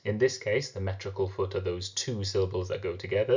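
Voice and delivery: ordinary delivery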